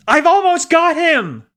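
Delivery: high, loud, slow reply; straining shout. A narrator's reading. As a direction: call back while still reaching. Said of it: "she replied".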